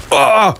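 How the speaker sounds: short high cry of impact; sharp cry. Acted. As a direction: yelp as you hit. Narrator hits bottom after falling.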